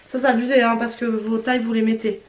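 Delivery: ordinary delivery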